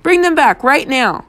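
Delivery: high, angry order; shouted. A narrator's reading. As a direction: shout the command down the phone. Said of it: "I shouted".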